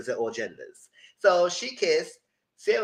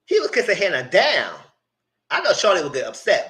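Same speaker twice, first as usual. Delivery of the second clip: normal talking voice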